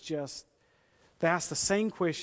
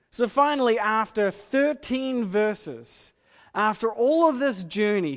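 loud, high, driving sermon voice; impassioned, loud pulpit delivery; preaching at full force; 5 semitones higher, 9 dB louder than usual. Acted. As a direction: build it up and drive the point home.

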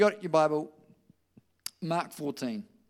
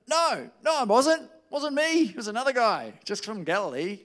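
higher, hurried denial; higher mock-panicked Peter denying it; 6 semitones higher, 4 dB louder than usual. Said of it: "he's denying it".

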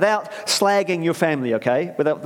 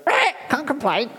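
high squawky parrot, much higher; 11 semitones higher than usual. Acted. Family parrot repeating a catch cry, high squawk. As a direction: screech it like a parrot on your shoulder. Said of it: "So it kind of goes".

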